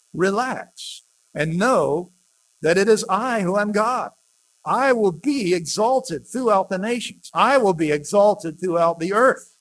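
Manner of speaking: loud, rising, proclaiming psalm reading; scripture reading. Acted. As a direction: declaim the psalm to the congregation. Solemn psalm reading aloud.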